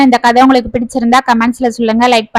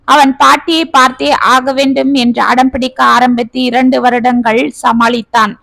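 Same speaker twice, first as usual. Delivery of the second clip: usual speaking voice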